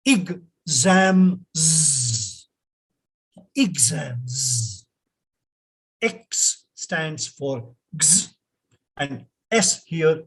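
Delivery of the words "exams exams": "Exams" repeated as egg-ZAMS with a z. Shown as a demonstration. Teacher repeating the model word for class. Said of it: "it is exams".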